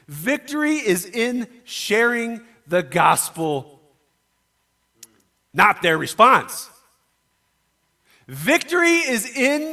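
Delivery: loud, high, slow and emphatic, each phrase hammered home; preaching at full force. Acted. Pastor urging his congregation, preaching at full force. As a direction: preach it like the punchline of the sermon.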